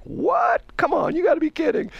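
high-pitched incredulous reaction; mock disbelief. Host retells people's reaction to his story, incredulous. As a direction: jump way up in pitch, as if astonished.

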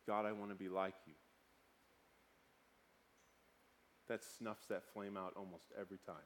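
low, soft, reflective murmur to himself; calming himself. Retold or imitated. Father reminding himself of God's mercy, quiet and calm. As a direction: drop right down into a hushed prayer.

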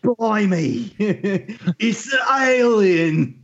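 high, slow mock British accent that slides Australian; British accent gone Australian. Co-host doing the dumbfounded witness, British accent sliding Australian. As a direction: ham up a British gawker who sounds Australian. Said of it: "yours comes out as Australian".

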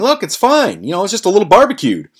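high, quick mock reassurance; imitated downplaying. Imitates Tone playing down the damage.

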